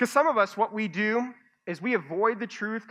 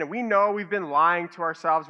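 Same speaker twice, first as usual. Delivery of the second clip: normal talking voice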